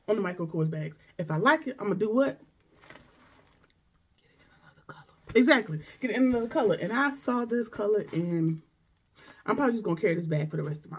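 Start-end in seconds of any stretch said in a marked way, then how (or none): 4.2-5.0 whisper. Pretend. Audience answer whispered. 5.4-6.8 emphatic. Real, not acted. Answering own question.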